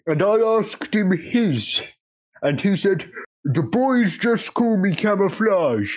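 put-on rambling old-timer storyteller; old-timey old man voice. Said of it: "an old-timey old man voice".

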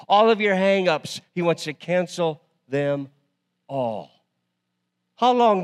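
loud, high, rhythmic preaching; full-force preaching. Acted. Pastor rallying the congregation, full-force preaching. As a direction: hammer each paid like a drumbeat.

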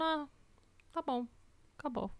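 quiet little anticlimax; underwhelmed reaction. Retold or imitated. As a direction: say it small, mildly let down. Acting her let-down at the ending.

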